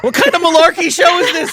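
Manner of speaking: high-pitched, playful indignation; mock outrage.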